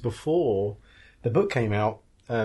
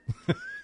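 high giggle. Real, not acted. Laughs after excited quote.

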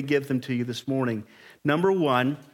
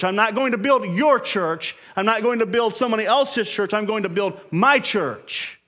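loud, high, driving sermon voice; preaching at full force; 7 semitones higher, 4 dB louder than usual. Acted. Pastor drives home Christ owns the church, preaching hard.

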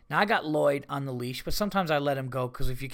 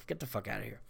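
low, soft deadpan scoff; understated, much softer incredulous aside; quiet disbelief; 6 semitones lower, 13 dB quieter, faster than usual. Retold or imitated. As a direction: drop right down, muttering in disbelief. Retelling his disbelief.

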